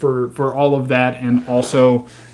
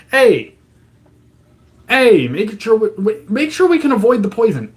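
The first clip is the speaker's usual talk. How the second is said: mock pleading citizen; 8 semitones higher than usual. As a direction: play a desperate citizen calling out. Acting out people pleading with government.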